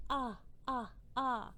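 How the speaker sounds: ah said as the short O; short O sound. Shown as a demonstration. Teacher voicing the letter O. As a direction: repeat ah like the O says it. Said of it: "the O is saying".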